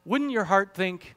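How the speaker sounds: ordinary delivery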